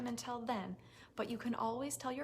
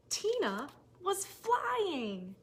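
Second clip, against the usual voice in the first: slow amazed reveal; 7 semitones higher, 4 dB louder, much slower than usual. A narrator's reading. Climax of the story.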